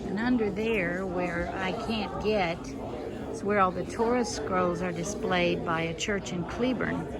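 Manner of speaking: muffled, talking through a mask; through a face mask. Real, not acted. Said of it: "I'm trying to talk through my mask".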